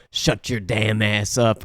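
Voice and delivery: low, slow tough-guy retort; Steven Seagal. Retold or imitated. Action star cutting off an old friend, slow low impression. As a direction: lightly imitate a curt action star. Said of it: "And Steven Seagal's like".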